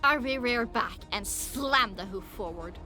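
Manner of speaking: ordinary delivery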